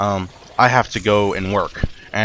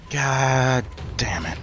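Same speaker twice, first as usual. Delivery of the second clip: very slow, higher, drawn-out groaned curse; exasperated dismay; 4 semitones higher, 3 dB quieter, much slower than usual. Retold or imitated. Seeing the shipment pile knocked over, drawn-out exasperation. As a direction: stretch each word in weary frustration.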